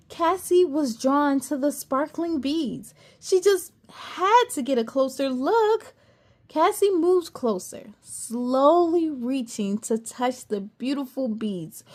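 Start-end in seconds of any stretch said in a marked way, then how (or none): none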